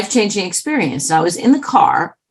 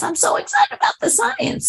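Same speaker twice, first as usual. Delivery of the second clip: mock excited squeal; 3 dB quieter than usual. Quoted woman excited about science.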